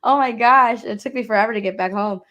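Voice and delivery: ordinary delivery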